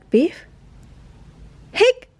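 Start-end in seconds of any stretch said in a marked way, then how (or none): 1.7-2.1 sharp high hiccup sound; a little squeaky hic. Acted. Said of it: "said Keeper".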